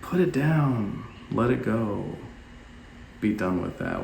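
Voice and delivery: slow, soft letting-go; slowly, lightly imitated calm; gentle release. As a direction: ease them into dropping it. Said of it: "and you're like".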